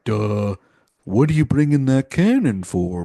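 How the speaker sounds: dopey lightly voiced meathead guard. Dumb guard questioning the boss about the cannon, mildly dopey. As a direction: play a slow-witted brute, a touch dumb. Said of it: "classic meathead dumb guys".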